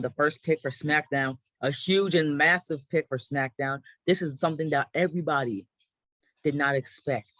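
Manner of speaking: ordinary talk, nothing marked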